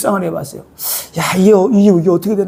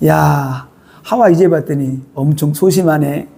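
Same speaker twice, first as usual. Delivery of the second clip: whispering tempter; 7 semitones lower, slower than usual. Acted. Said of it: "하와에게 속삭입니다".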